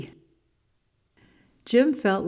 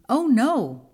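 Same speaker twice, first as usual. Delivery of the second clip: higher-pitched mock dismay; storybook alarm; 6 semitones higher, 4 dB louder than usual. A narrator's reading. Monkey hears he has spring fever, alarmed. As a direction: play a little panic for young listeners.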